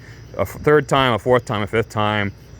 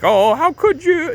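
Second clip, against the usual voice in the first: very high, drawn-out fake wounded wail; hammed-up victim voice, slow and high; crocodile tears; 3 dB louder, slower than usual. Tifa playing victim to Rufus, crocodile tears. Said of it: "crocodile tears".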